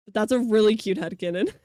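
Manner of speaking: normal talking voice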